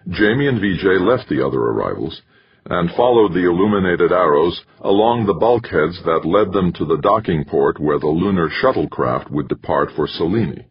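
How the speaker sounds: ordinary delivery, nothing marked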